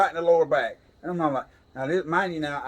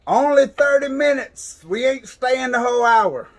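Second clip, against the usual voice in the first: higher, louder call through the wall; calling from another room; 9 semitones higher, 6 dB louder than usual. Retold or imitated. Wife calling from the next massage room, raised far-off call. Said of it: "my wife from the other room going".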